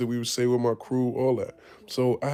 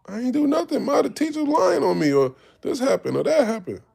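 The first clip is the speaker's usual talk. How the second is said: put-on very high, louder pleading child voice; imitating a kid crying unfair to mom; whiny kid protest; 12 semitones higher, 4 dB louder than usual. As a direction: whine your innocence to your mother.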